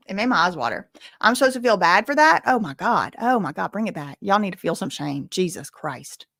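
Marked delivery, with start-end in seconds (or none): none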